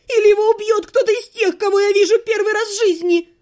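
high, loud hysterical yell; girl screams for the king amid the chaos; shouting demand. A narrator's reading. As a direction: demand the king at the top of her voice. Hysterical retort.

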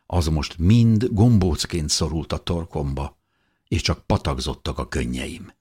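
ordinary delivery, nothing marked

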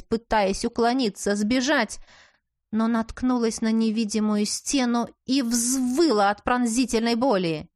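ordinary delivery, nothing marked

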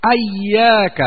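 "إياك" opening with a-vowel, ayyāka; hamza with fatha. Shown as a demonstration. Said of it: "بفتح الهمزة". ("إياك" بفتح الهمزة)

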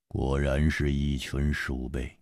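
low, slow, cold scorn; an icy low verdict; cold contempt. Acted. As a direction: sneer it quietly. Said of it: "冷冷地说".

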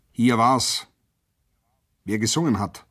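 mildly sharp questioning; cutting demand. A narrator's reading. Lieutenant demands who sang, sharp. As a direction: press them for the culprit. Lieutenant interrogates prisoners again.

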